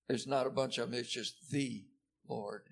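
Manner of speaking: normal talking voice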